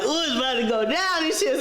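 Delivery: high-pitched, gleeful anticipation; excited. Retold or imitated. Telling how she awaited her man's showdown, excited. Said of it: "You're excited".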